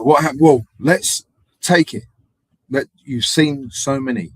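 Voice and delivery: high, loud mock exclamation; hammed-up loud question; mock loud quote. Retold street guy, mock loud and high.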